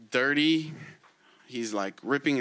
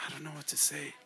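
hushed despair; 13 dB quieter, faster than usual. Acted. Despairing Moetsi gives up.

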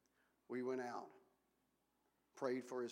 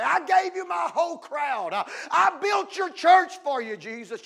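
much higher and louder shouting throughout; mock boastful shout; 13 semitones higher, 20 dB louder than usual. Preacher voicing John the Baptist bragging to Jesus, shouted. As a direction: yell it like a braggart demanding credit.

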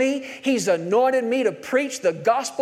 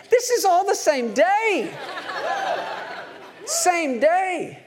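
laughing amazement; 9 semitones higher, slower than usual. Real, not acted. Preacher marvels, laughing.

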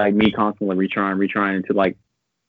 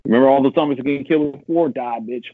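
higher, cocky mock taunt; gloating taunt; 6 semitones higher, 3 dB louder than usual. Player taunting zombies with an unlimited rocket launcher, gloating. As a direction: lord it over the zombies.

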